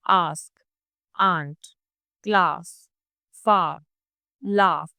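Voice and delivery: long open back vowel, lips relaxed; example words. Shown as a demonstration. Teacher modelling the long aa sound for learners. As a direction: stretch the long aa in each word. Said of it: "अब words देख लेते हैं".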